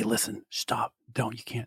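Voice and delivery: hushed whisper so the dog can't hear. Real, not acted. Hushing so the dog won't hear.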